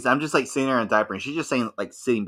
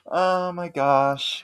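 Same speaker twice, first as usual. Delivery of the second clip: very slow, higher mortified gasp; slow embarrassed oh my gosh; 8 semitones higher, much slower than usual. Retold or imitated. Caught by the roommate, slow mortified oh my gosh. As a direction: stretch it out, mortified. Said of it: "I was like".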